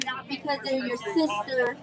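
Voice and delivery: ordinary delivery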